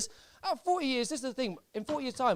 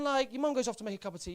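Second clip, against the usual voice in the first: ordinary delivery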